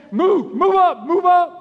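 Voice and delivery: high, loud pushy calling out; urgent shout. Pretend. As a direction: shove the crowd forward.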